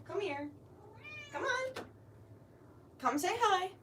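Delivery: high, soft coaxing pet voice. Real, not acted. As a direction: sweet-talk the dog into coming over. Coaxing her dog to the camera.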